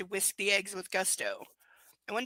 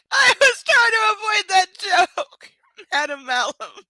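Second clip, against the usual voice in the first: high, loud laughter through the words; laughing; 9 semitones higher, 13 dB louder than usual. Real, not acted. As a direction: crack up at the joke. Laughing at own joke.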